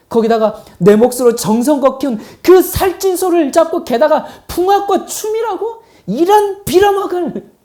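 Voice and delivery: 점점 높고 크게 치닫는 분노 연기; 어이없어 되묻다가 욕설로 폭발하기; 길길이 날뛰는 큰아들. Acted. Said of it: "그렇게 길길이 날뛰었을지도". (격분해서 점점 언성을 높이며)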